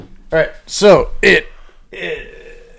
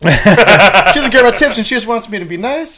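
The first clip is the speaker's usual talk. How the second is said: laughing; 9 semitones higher, 9 dB louder, faster than usual. Real, not acted. Laughing while repeating.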